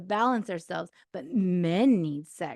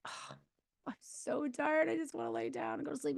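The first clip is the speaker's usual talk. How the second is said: mock exhausted whine; 6 semitones higher, 8 dB quieter than usual. Wife's tired reply voiced.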